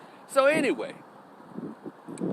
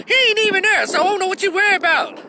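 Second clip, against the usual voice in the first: high, raised mock protest; imitated protesting woman; 14 semitones higher, 4 dB louder, faster than usual. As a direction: mimic an exasperated girlfriend, voice way up.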